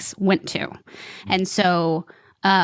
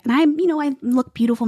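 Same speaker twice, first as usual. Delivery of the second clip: usual speaking voice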